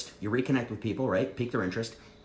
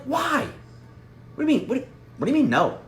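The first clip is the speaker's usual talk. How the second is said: much higher, startled disbelief; shocked; 13 semitones higher, 3 dB louder than usual. Shown as a demonstration. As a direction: sound genuinely thrown by the no. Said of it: "be shocked".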